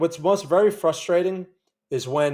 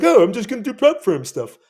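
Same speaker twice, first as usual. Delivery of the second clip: higher, louder airy voice of a careless beginner; mock casual whim; 7 semitones higher, 5 dB louder than usual. Trader mocks an imagined careless beginner, airy and offhand. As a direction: toss it off like a naive impulse. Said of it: "It's not like one day I'm like".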